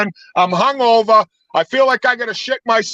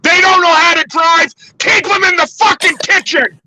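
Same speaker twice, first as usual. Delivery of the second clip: heated shouting rant; 5 semitones higher than usual. Real, not acted. Drunk driver ranting to his podcast friends, heated shouting. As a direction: rant like you're losing it behind the wheel.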